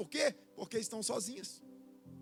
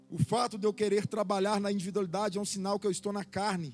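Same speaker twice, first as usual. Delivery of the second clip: loud, higher fervent preaching; 4 semitones higher, 9 dB louder than usual. Acted. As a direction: preach with rising force. Preaching builds again.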